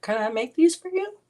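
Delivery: high, teasing and giggly; playful high voice. Real, not acted. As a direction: tease them lightly.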